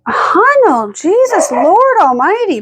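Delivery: high exasperated cry; exasperated plea. Retold or imitated. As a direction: owner imitating own exasperation. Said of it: "but I'm like".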